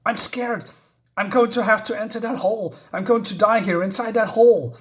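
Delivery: high-pitched acted panic; flipping out, emotional. Woman dreads entering the hole, acted high panic. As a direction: imitate someone frantic with dread. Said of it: "flipping out, you know, he's really emotional".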